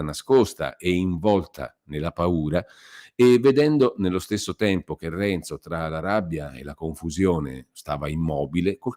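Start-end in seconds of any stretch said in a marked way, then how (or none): none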